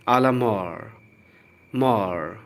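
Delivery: "mort" said as "MOR", with the T silent; careful pronunciation. Shown as a demonstration. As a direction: repeat "mort" and drop the T. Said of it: "ध्यान से सुनिए". ("mort" को "मॉर" बोलें)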